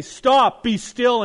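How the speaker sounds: commanding call. Retold or imitated. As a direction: call out stop like Jesus rebuking the waves. Voicing Jesus calming the storm.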